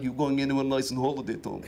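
low, soft Eastern European small talk; flat Eastern European accent. Retold or imitated. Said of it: "that flat Eastern European accent".

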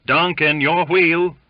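high, loud shout to a shipmate; calling out. A narrator's reading. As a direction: call him sharply to his duty. Crew calling Duncan to the wheel.

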